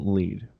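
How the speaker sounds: "Lied" said with a long ee; Lied as "leed". Shown as a demonstration. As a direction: pronounce "Lied" to rhyme with "need". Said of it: "two ways to pronounce it".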